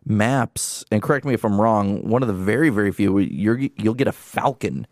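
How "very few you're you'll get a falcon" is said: normal talking voice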